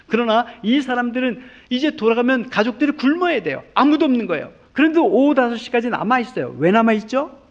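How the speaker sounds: fervent sermon climax; impassioned preaching. Acted. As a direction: drive the plight home from the pulpit. Preaching at full force.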